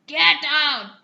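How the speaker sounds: loud furious yell. Acted. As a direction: bellow at the intruder. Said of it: "he yelled".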